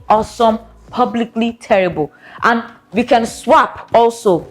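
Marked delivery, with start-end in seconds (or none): none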